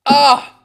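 loud, high sigh after a swig; satisfied "ah" after drinking. Real, not acted. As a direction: let out a big post-sip "ahh".

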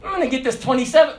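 high, sly mock landlord voice, quick at the end. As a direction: ham up a greedy landlord spotting a bigger rent. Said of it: "They like to say".

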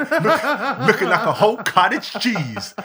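loud, laughing through the words. Real, not acted. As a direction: crack up while saying it. Laughing at cottage cheese joke.

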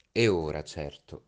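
ordinary delivery, nothing marked

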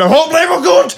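high, loud mock auntie voice; mock old man growl. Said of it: "made her sound like an old man".

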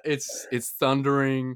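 normal talking voice

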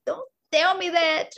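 high, protesting comeback; exasperated retort. Retold or imitated. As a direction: exclaim it like you don't want to know. Snapping back at partner, retold.

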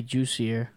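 "Juicier" said as JOO-see-air; suggested pronunciation. Shown as a demonstration. Co-host helping with a fighter's first name, "Juicier" pronounced with a J. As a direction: say "Juicier" with a J sound at the start. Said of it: "I think they actually say".

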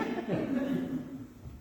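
short, unvoiced chuckle; amused laugh. Real, not acted. Preacher amused by his Halloween remark, soft laugh. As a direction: chuckle at your own aside.

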